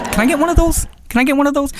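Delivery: high-pitched mock request; mock casual asking. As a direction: put on a high, wheedling ask. Acting out a casual request to borrow.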